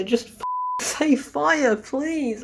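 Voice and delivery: high, slow, pleading exasperation; exasperated plea. Real, not acted. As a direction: beg them to just say it.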